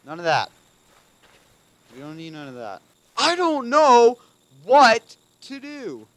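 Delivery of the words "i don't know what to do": high, loud and drawn-out panicked scream; screaming in panic, much higher and louder. Real, not acted. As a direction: wail it in full terror. Panicking while chased.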